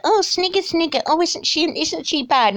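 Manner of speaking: high snide mock whisper-gossip. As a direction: sneer like a gossiping congregant. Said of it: "and like".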